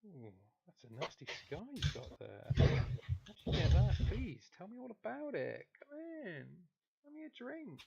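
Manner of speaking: high, coy come-on voice; flirty barmaid. Acted. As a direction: flirt to draw them in.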